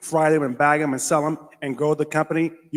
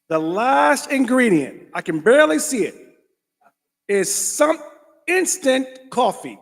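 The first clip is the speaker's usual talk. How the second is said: high, slow, louder disbelief; incredulous reveal; 8 semitones higher, 4 dB louder, slower than usual. Real, not acted. Vlogger finding coffee last on label, high slow disbelief. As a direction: stretch it out in mock shock.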